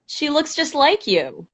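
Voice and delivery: high, slow pet talk; cooing to a pet. Real, not acted. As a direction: coo sweetly to the cat. Talking to the cat.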